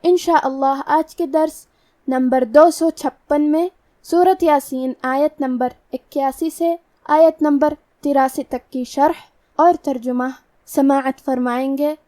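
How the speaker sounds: ordinary talk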